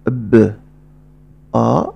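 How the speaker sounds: ب then آ, each separately; sounds apart. Shown as a demonstration. Teacher splitting the syllable: ب, then آ. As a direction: say ب, pause, then آ. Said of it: "فإنني أنطقه هكذا".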